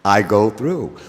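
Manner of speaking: usual speaking voice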